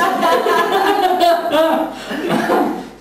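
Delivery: long high-pitched burst of laughter. Real, not acted. Trainer cracking up at the sarcasm joke, long high laugh.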